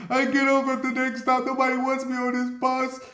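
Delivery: mock the man's whiny exit line; imitating the guy.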